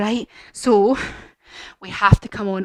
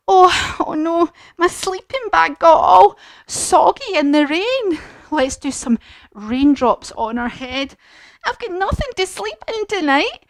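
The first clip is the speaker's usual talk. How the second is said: high, mock tearful fox voice; upset fox; 7 semitones higher than usual. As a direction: whimper it like a sad little fox. Fox upset, crying in story.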